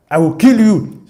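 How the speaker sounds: loud threat to kill; threatening. Retold or imitated. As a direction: bark the threat at him. Hunter threatening Narada.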